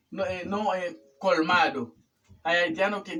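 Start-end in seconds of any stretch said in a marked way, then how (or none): 1.2-1.9 "Colmado" with the final o sounded; "Colmado" keeping its last vowel; correct full ending. Shown as a demonstration. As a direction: say "Colmado" in three syllables, col-MA-do. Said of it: "es colmado".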